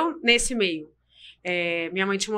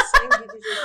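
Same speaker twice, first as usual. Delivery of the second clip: laughing exclamation; 14 semitones higher, 4 dB louder than usual. Real, not acted.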